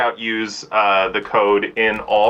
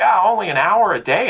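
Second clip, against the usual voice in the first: higher-pitched imitated grumble; mock complaint; 10 semitones higher, 4 dB louder than usual. Player complaining about short daily play, mock whine.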